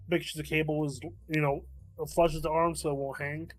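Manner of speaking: usual speaking voice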